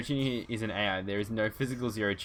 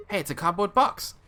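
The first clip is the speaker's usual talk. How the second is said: excited; 9 semitones higher, 5 dB louder than usual. Acted. Excited Valley Girl line.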